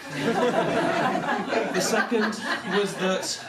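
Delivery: giggling as the words drag out; laughing, drawn out. Real, not acted. Telling the audience about the stairs, slow and laughing. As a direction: stretch it slowly through a laugh. Comic buildup to punchline.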